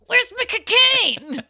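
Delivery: high, loud, slowed toy voice; pull string toy. Retold or imitated. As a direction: squawk like a talking toy. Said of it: "The Liza goes".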